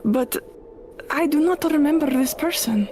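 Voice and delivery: lightly voiced confused witch, formal; a hint of a lonely bewildered witch; witch character voice. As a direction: speak stiffly, without contractions. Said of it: "and she goes".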